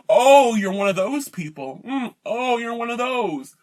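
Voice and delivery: scornful laughing imitation; mocking laugh. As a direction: laugh it off like a condescending snob. Mocking people who laugh at her.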